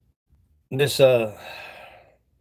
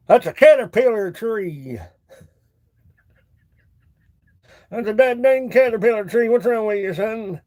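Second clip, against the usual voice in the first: hillbilly; 5 semitones higher than usual. Pretend. Hillbilly voice continues.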